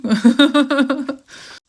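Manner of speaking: loud, high burst of laughter; amused laugh. Real, not acted. Reader amused at sounding Canadian, bursting out laughing. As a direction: crack up at your own slip.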